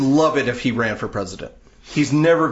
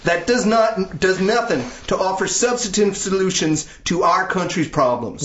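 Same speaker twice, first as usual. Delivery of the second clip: higher-pitched Southern accent; 6 semitones higher than usual. Shown as a demonstration. Host resumes reading the statement after an aside, Southern accent. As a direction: carry on the statement in mock Southern tones. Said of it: "statement in a Southern accent".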